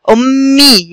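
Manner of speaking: "أمي" slowly, with a long hummed m and drawn-out ee; doubled m demonstration. Shown as a demonstration. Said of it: "حرف مكسور".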